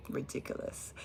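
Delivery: whispered scorn; disgusted whisper. Real, not acted. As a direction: hiss out "Ridiculous." under your breath. Disgusted at voters.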